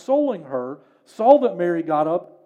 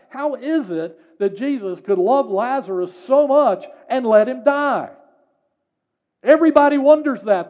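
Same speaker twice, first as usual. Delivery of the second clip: preaching at full force; 4 semitones higher, 5 dB louder than usual. Real, not acted. Preacher building rhetorical questions.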